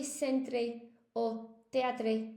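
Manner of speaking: "centre" and "teatre" slowly, each ending in -tray; wrong pronunciation. Shown as a demonstration. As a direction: sound out the final -re of "centre", "teatre". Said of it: "Please do not say".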